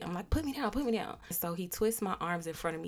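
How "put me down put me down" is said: lightly imitated protesting child; higher-pitched, repeated plea to be released; like a kid. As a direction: play a kid squirming to get down. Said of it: "I'm like a kid".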